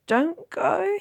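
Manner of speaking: put-on high, loud pleading boy. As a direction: beg with all you have. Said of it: "he pleaded".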